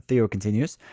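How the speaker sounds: ordinary talk, nothing marked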